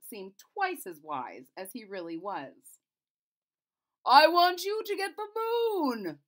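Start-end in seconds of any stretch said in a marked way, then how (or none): 4.1-6.2 much higher, loud royal order; king ordering the Lord High Chamberlain, loud and high; commanding king. Acted. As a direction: demand it like a king. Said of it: "said the king".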